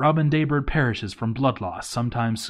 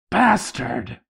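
angry curse; 5 semitones higher, 4 dB louder than usual. Acted. Cursing the dwarf who failed her.

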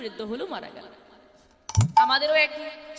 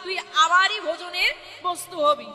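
gloating tiger; 4 dB louder than usual. Acted. Tiger taunts the cow stuck in the mud, gloating loudly. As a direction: crow over trapped prey, stretching the first call. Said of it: "খুব হাসছে".

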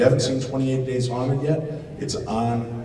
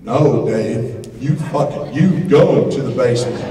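loud mock refusal; mock indignant yell; 3 semitones higher, 10 dB louder than usual. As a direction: hurl it back at Dave in outrage. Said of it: "he goes".

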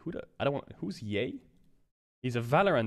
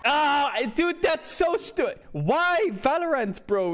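outraged disbelief; 7 semitones higher, 4 dB louder, slower than usual. Real, not acted. Streamer reacting to award loss.

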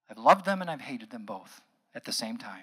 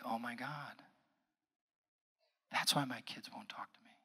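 hushed whisper; 2 semitones lower, 9 dB quieter than usual. Retold or imitated. Client realizing, retold in whisper.

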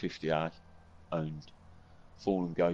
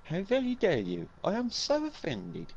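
much higher, prissy mock indignation; mock offended; 13 semitones higher than usual. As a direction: play the wounded diva, high. Teasing back with mock offence.

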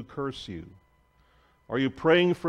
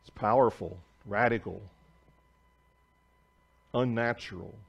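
closing prayer; 2 semitones lower than usual. Acted. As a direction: pray gently over the text. Pastor leads closing prayer.

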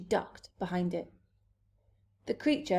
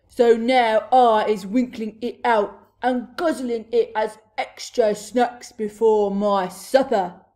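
loud, menacing giant voice; booming; 11 dB louder than usual. A narrator's reading. Blood Bottler threatening to eat the pet, booming. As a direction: thunder out the threat. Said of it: "boomed the Blood Bottler".

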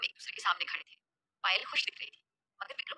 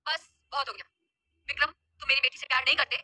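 louder, raised angry voice; 5 dB louder than usual. A narrator's reading. Bride's father confronts the groom, angry and loud. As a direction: cut him off in anger. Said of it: "Kabir गुस्सा आ गया था".

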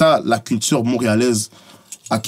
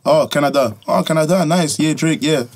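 mock traveler chat; 4 semitones higher than usual. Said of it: "Moi, comme dit".

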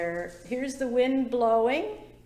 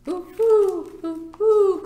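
high, louder hooting; owl hoot; 8 semitones higher, 9 dB louder, slower than usual. A narrator's reading. Teacher voicing an owl for the children, loud hoots. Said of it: "called the owl".

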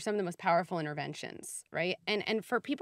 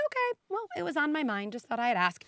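much higher, airy and innocent; breezy casual; 7 semitones higher than usual. Pretend. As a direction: shrug it off lightly to the kid. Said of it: "just be like".

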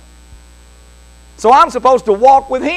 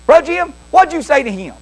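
put-on higher-pitched scolding old lady; little old ladies; 6 semitones higher than usual. Church ladies questioning Bro Jim about crying boy, reproachful. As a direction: imitate concerned church ladies. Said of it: "these little old ladies".

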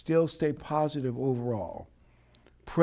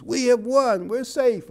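higher, louder cry of victory news; joyful messenger cry; 10 semitones higher, 8 dB louder than usual. Retold or imitated. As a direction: call it out like a runner with good news. Messenger shouting good news.

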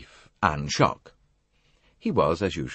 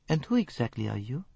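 softer, sugary question; sweetly; 7 semitones higher, 5 dB quieter than usual. A narrator's reading. Poirot needling Ferguson, sweet and soft. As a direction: ask it with mock innocence. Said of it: "asked Poirot sweetly".

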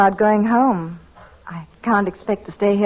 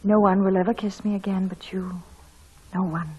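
soft whispered promise; whispered vow to her new husband; tender whisper; 2 semitones lower, 4 dB quieter than usual. Acted. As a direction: whisper it close, full of love. Tender love scene, whispered vows.

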